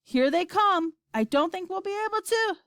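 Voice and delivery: high, tense last message; alarmed. A narrator's reading. Astronaut as the attackers reach the antenna, alarmed. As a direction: raise the pitch in alarm, breaking off unfinished.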